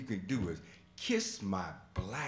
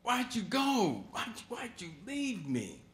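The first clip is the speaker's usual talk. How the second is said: angry grief; 9 semitones higher than usual. Retold or imitated. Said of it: "I remember getting angry at my father".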